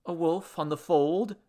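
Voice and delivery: questioning offer of the missing words; tentative rising question; helpful prompt. Acted. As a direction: suggest it with a rising end. Henry prompts the missing phrase.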